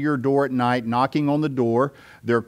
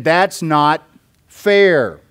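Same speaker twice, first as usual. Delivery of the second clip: drawn-out, louder protest, stretching "fair"; objector's protest; 2 semitones higher, 5 dB louder, much slower than usual. Acted. Preacher voicing a reader's objection to God's choosing, slow protest. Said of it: "the objection to that is".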